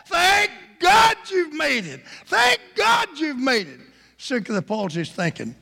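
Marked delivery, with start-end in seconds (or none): 0.1-3.8 high, loud shouted thanks; excited cheering. Acted. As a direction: celebrate at the top of the voice. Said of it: "Got Hope and Love so excited".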